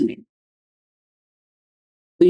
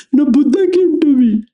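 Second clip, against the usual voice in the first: much higher and louder, gushing devotee; mock devotee; 10 semitones higher, 5 dB louder than usual. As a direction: ham up the starry-eyed believer. Acted devotee reports a vision.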